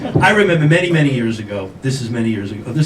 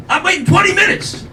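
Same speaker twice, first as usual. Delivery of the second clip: much higher, strained mock outrage; indignant complaint; 13 semitones higher than usual. Customer fed up with waiting in line, indignant complaint. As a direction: protest in a high, exasperated voice. Acted complaint over the wait.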